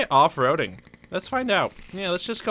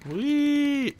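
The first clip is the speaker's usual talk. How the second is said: high squeal of fun; gleeful whee; 13 semitones higher than usual. Real, not acted. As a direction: shriek with joy. Jumping with the vehicle.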